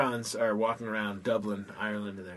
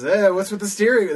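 higher, louder questioning call; acted bartender; 8 semitones higher, 8 dB louder than usual. Bartender questions the pirate about his pants, calling out.

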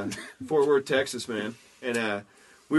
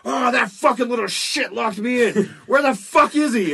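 loud, high angry rant; furious man; 8 semitones higher, 6 dB louder than usual. Retold or imitated. Freed old man rages about the kid who locked him in. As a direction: storm out hunting the kid. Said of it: "he's like".

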